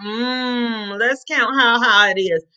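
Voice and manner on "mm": "Mm" as a held, soft hum; M sound. Shown as a demonstration. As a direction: hum "Mm" as one long consonant. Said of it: "what's the M sound".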